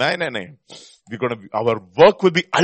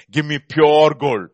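slow, stressed pure gold; emphatic request; 5 dB louder, much slower than usual. Shown as a demonstration. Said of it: "you know how I ask for the gold?".

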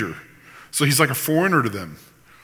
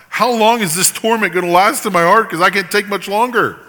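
rising, louder, pleading demand, building fast; blunt crying out to God; 6 semitones higher, 6 dB louder than usual. Shown as a demonstration. Preacher models blunt complaint to God, building to a loud plea. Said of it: "Say that to him".

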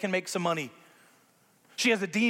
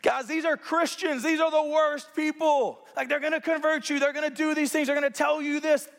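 high, mocking taunt, building to a yell; mocking slave girl; 9 semitones higher than usual. Slave girl mocking Paul and Silas, shrill high taunt. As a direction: sneer at the Christians like a gossiping girl. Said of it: "the way it comes off is like".

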